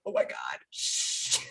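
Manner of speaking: mortified, hush them fast; embarrassed whisper. Retold or imitated. Mother hushing her children in front of the other mom, whispered.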